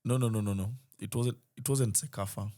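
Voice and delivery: ordinary delivery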